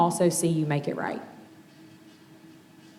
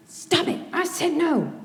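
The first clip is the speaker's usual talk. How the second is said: high, sharp mock snap; snapping at child; 11 semitones higher than usual. Mom snapping at her son over dog food. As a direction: bark it at the kid who won't stop. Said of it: "I snap and I go".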